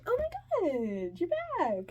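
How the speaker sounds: very high, stretched-out delight; gushing drunk woman. Retold or imitated. Tipsy stranger greeting the returning kid, gushing. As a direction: squeal a drawn-out greeting. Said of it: "she goes".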